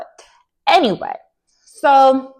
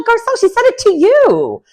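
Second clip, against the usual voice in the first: much higher, incredulous call-out; exasperated retort; 4 dB louder than usual. Retold or imitated. Viewer calling out Garcelle over what was said, exasperated. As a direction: throw it at her in high-pitched disbelief.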